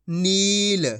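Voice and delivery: नील with a long, sustained ee; drawn-out long ee. Shown as a demonstration. Teacher models the joined word, long ee drawn out. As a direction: stretch the middle vowel of नील. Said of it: "खींच के पढ़ेंगे".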